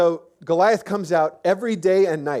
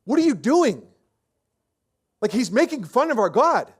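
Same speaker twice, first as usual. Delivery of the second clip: high, louder indignant outburst; indignant David; 7 semitones higher, 4 dB louder than usual. Retold or imitated. David to his brothers, high indignant protest. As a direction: protest that they mock God. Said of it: "he's like".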